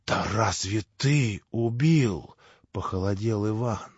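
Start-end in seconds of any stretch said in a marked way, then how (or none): none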